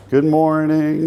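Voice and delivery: high sing-song good morning; cheerful greeting. Real, not acted. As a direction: greet the staff sweetly. Greeting staff again.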